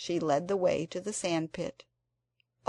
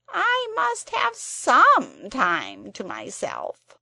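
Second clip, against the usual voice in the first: loud, higher grumbling creature; complaining Psammead; 6 semitones higher, 10 dB louder than usual. A narrator's reading. The Psammead complains to Anthea about endless wishes. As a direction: grumble like an overworked servant.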